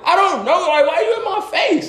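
high, laughing exasperation; high mock-annoyed question; exasperated. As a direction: push the kid off with a laughing whine. Annoyed at kid.

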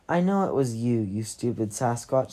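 ordinary delivery, nothing marked

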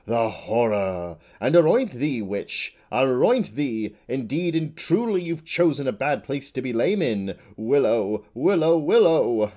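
loud theatrical recitation of quotes; hammed-up declaiming. Butterfly showing off quotations, loud and theatrical. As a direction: boom the lines like a ham actor.

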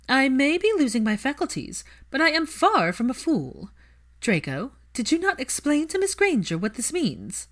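high and loud imperious mother; Narcissa's voice. A narrator's reading. Narcissa reproaches Draco before Hermione, high and loud. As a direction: scold him like an affronted aristocrat.

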